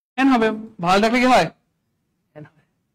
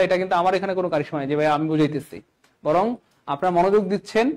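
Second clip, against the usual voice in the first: ordinary talk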